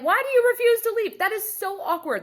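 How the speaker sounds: high, incredulous squeal of disbelief; can't believe anyone would do that; baffled and exasperated, voice shooting up high. Real, not acted.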